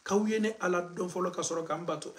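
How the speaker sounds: normal talking voice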